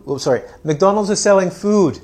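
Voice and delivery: ordinary talk, nothing marked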